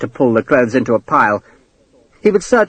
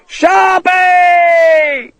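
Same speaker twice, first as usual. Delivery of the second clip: long, high wail of a name; shout; 3 dB louder than usual. A narrator's reading. Abandoned man among snakes, long desperate high shout. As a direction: hold the name in one drawn-out yell. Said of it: "he shouted".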